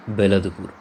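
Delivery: plain reading voice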